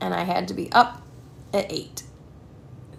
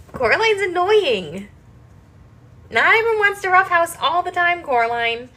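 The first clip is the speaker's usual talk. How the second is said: mock scolding; 8 semitones higher, 5 dB louder than usual. Real, not acted. Scolding cat in pet voice.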